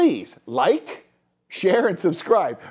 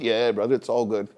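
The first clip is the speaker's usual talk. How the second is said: low-pitched casual bro voice; low, easygoing parody; mock bro reply; 10 semitones lower than usual. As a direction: answer like a laid-back bro. Acts out bro small talk.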